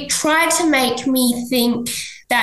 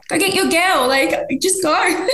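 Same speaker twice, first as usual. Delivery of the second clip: mock-generous send-off; dismissive; 7 semitones higher than usual. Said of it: "I'd be like".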